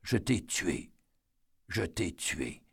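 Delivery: a man choking out his guilt, voice dropping away; breathless confession. A narrator's reading. Eddie confessing to the girl he killed, breathless and fading. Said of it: "craché sous la pression essoufflée de la confession".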